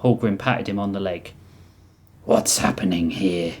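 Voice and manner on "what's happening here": low, slow, groggy slur; dozy slur. A narrator's reading. Oryx wakes dozy from stunning, low slow slur. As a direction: slur it slowly, as if just coming round. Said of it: "Oryx slurred, still dozy from the stunning".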